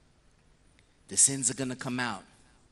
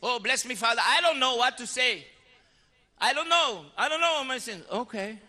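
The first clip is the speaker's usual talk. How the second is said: flustered penitent; 8 semitones higher, 4 dB louder than usual. Retold or imitated. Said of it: "You might say".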